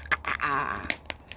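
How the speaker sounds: low, breathy villain laugh; evil giant chuckle. Acted. Giant gloating over the girl he will eat, low chuckle.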